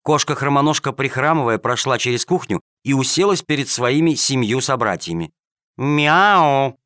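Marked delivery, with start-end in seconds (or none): 5.8-6.8 defiant meow. Acted. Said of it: "с вызывающим видом".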